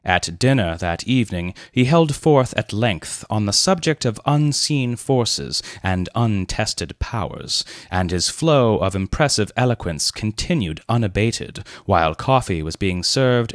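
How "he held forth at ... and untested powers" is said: ordinary delivery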